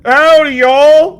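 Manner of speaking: American cowboy accent. Shown as a demonstration. DM mocks a wrong accent with an American greeting, American accent. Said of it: "an American go to U K and be like".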